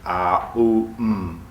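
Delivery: ah, oo, mm drawn slowly as separate sounds from throat to lips; sounding out om. Shown as a demonstration. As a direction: spell out om as ah, oo, mm. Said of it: "which actually is pronounced".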